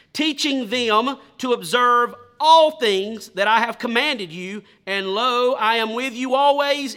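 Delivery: loud, measured reading of the Bible passage; scripture reading. Acted. Preacher reading scripture aloud to the congregation, loud and solemn. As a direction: proclaim the verses to the church.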